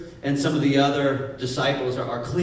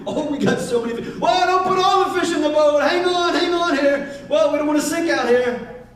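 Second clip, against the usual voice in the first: much higher and louder frantic shout; panicked fishermen; 11 semitones higher, 3 dB louder than usual. Retold or imitated. Fishermen in a sinking boat, frantic and loud. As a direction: hang on, we're sinking.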